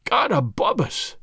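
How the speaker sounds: slow, choked exclamation. A narrator's reading. Man at the grave sees a supposed ghost, choked. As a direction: choke it out slowly in shock. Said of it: "he said in a choked voice".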